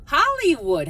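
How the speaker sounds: sudden loud, high Hollywood; excited exclamation. Acted. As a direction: burst out with Hollywood. Repeats name, then exclaims.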